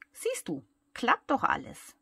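pleased, lilting I-told-you-so; mildly gleeful, lifting at the end; light triumph without real giggles; giggly. A narrator's reading. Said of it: "kichert die bunte Luftmatratze".

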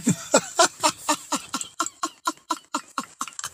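squeaky high laugh cracking up; high giggle. Real, not acted. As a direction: let a high giggle burst out. Laughing at his own skit.

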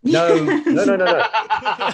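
high, loud laughing no-no; laughing denial, drawn out slowly; laughing protest. Real, not acted.

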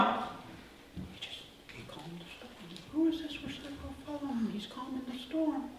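hushed, very soft, high murmur; stunned, secretive hush; awed whispering. Acted. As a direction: whisper in amazement behind his back. Awed disciples murmur among themselves.

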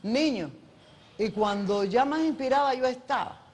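ordinary talk, nothing marked

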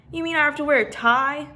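a hint of dismayed little boy; mournful. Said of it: "Benny said mournfully".

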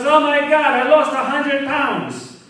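loud, high, slow wail of amazement; astonished exclamation. Acted. Drunk thinks the parking meter weighed him, astonished exclamation. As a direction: cry it out in drunken disbelief.

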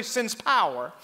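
normal talking voice

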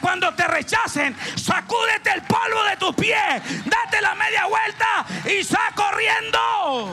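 loud, high, slow shouted command; loud preaching shout, slowing at the end. Real, not acted. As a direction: thunder it from the pulpit.

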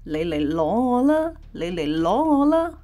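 lightly voiced parcel, coaxing come take me; a hint of a coaxing, beckoning voice; teasing parcel voice. Parcel voiced as if inviting theft.

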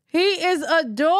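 ordinary delivery

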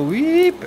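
high-pitched "weep" swoop; a quick squeaky zip sound for a moving finger; imitated sliding noise. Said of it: "I was going like this".

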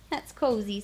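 ordinary delivery

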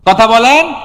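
loud call with rising pitch; loud prompt. Real, not acted. Preacher demanding an answer from the congregation, loud prompt. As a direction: push the crowd to speak up.

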